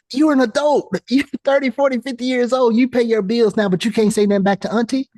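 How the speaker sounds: high-pitched, animated disbelief; incredulous challenge. Real, not acted. Coach challenging adults who won't answer auntie back, high and incredulous. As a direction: start high and keep pressing the point.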